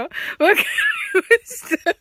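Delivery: normal talking voice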